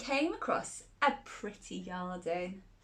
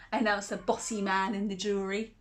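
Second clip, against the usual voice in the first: ordinary delivery, nothing marked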